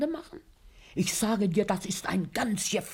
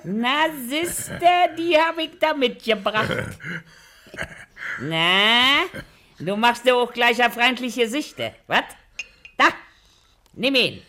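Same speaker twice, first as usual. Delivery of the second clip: coaxing; 5 semitones higher, 6 dB louder than usual. Acted. Wife plying husband with schnapps.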